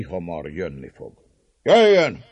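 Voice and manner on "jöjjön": defiant. A narrator's reading. Dózsa defiantly welcomes the enemy.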